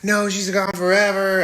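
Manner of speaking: high, hammed-up wail of loss; mock grief. Family mourning Carol Ann, a high mock lament. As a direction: whine it like the grieving family. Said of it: "And everybody's sad".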